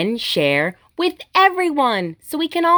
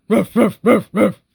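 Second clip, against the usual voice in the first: loud playful barking ruffs; dog barks; 5 dB louder than usual. Pretend. Reader voicing the dogs for kids, loud playful barks. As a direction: bark it out like an excited puppy. Animal sounds in picture book.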